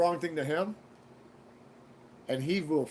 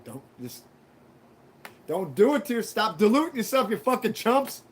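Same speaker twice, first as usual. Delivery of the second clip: rising into a loud, higher mock yell; mock Tyson yell; 5 semitones higher, 5 dB louder than usual. Imitating Tyson yelling at podcast hosts, mock yell. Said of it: "he straight up tell him".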